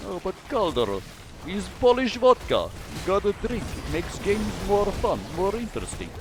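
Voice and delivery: put-on Russian accent. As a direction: play a tipsy Russian pushing vodka with a Russian accent. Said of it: "with a Russian accent".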